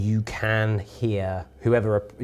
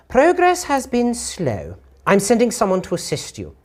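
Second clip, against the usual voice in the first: distorted female voice; 9 semitones higher than usual. Acted. Game master voices a distant woman to players, high lady voice. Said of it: "uh, female, but it sounds distorted".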